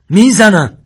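high, full-voiced final warning; shouted threat. A narrator's reading. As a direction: yell the threat without hesitation. Armed man's last warning, escalating.

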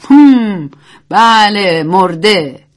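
voiced man answering with a touch of amusement; lightly amused character reply. A narrator's reading. Man agreeing that he is dead, mildly amused. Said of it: "مرد خندید و گفت".

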